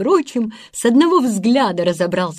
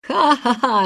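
higher acted ha-ha-ha; laughing; 5 semitones higher than usual. A narrator's reading. Peter laughs at the robber's pleading, mocking laugh. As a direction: laugh at the plea. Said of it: "рассмеялся Питер".

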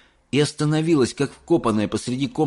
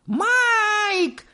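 mother shrieking. A narrator's reading. Mrs Teavee shrieking at her son to stop. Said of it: "завизжала миссис Телек".

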